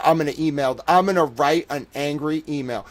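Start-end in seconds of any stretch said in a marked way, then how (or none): none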